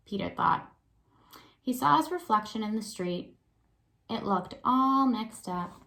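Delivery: put-on lively read-aloud for young kids; story narration. A narrator's reading. Teacher reads picture book aloud.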